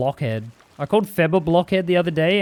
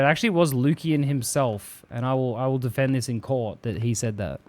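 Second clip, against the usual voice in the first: ordinary delivery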